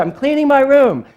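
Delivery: put-on kid's high, loud holler downstairs; yelling back. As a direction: imitate a child shouting back to mom. Child yells back from upstairs.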